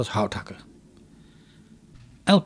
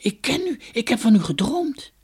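much higher, breathless with recognition; excited; 10 semitones higher than usual. Acted. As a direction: tell him eagerly you know him. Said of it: "riep Dunja". (opgewonden en veel hoger)

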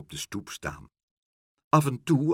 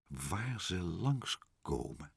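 very soft, slow musing; low, hushed pondering; murmuring; 4 semitones lower, 11 dB quieter, much slower than usual. A narrator's reading. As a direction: murmur it to yourself, drawn out. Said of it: "prevelde meneer Pen".

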